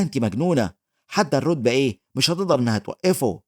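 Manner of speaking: plain reading voice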